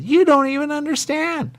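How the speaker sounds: very high, loud indignant cry; upset outburst. Retold or imitated. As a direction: shriek the accusation. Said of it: "Man, she upset".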